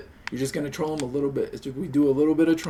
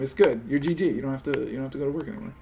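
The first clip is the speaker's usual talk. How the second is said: normal talking voice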